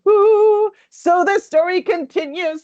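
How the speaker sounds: high, loud thrilled ooh; excited. Real, not acted. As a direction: gush with real delight. Excited reaction after reading.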